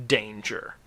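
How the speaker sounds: plain reading voice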